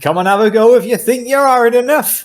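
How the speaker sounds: put-on strong West Country accent. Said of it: "say that in a stronger West Country accent".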